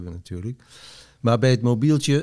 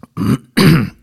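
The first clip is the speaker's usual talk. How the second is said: clears throat after a sip of water; a wet throat-clear after drinking; 10 dB louder than usual. Real, not acted.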